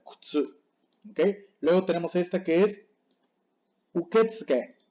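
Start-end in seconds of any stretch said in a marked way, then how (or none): 0.0-0.6 kutsu with a whispered first U, "k'tsu"; U like a sigh. Shown as a demonstration. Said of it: "es muy bajita". 3.9-4.8 uketsuke squeezed to "uketske"; silent middle U. Shown as a demonstration. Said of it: "la U casi no suena".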